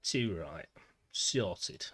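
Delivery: hammed-up northern English accent. Shown as a demonstration. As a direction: lay on the northern English twang. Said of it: "where we talk like this".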